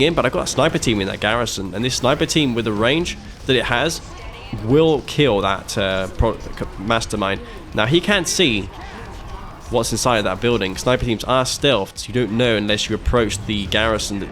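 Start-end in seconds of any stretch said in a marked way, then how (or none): none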